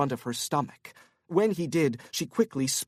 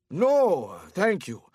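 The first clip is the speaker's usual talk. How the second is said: tipsy Korean businessman; 5 semitones higher, 4 dB louder than usual. Acted. Korean man character voice.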